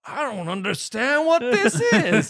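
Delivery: high, loud, slow mock old-timer; hammed-up baffled elderly singer; bewildered old man. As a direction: wail it slowly in confusion. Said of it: "he's so old".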